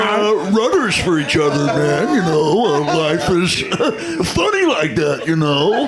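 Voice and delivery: jaw shifted sideways, easygoing dude; Jeff Bridges. Retold or imitated. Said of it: "So he goes".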